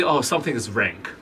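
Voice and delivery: normal talking voice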